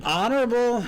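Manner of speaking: high, teasing honorific; mock grand. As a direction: sing out the title in jest. Teasing the guest's title.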